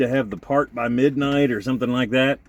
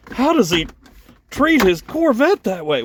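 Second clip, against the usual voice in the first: much higher, indignant viewer voice; mock shocked viewer; 11 semitones higher, 3 dB louder than usual. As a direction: play the appalled car lover, pitched way up. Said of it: "I know you're thinking".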